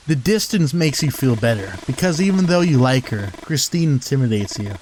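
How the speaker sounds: ordinary talk, nothing marked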